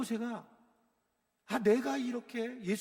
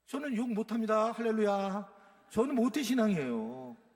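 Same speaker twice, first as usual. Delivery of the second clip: ordinary delivery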